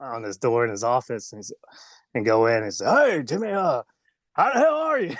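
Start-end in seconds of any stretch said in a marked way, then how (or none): 2.8-5.2 mock hearty boss greeting, pitched high; Vince McMahon. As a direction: greet the employee as an imitated big boss, rising sharply. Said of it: "go thank Vince McMahon".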